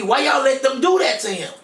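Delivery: furious retold shout at his sister; angry shout. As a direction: yell the accusation at them. Angry at family over feeding tube.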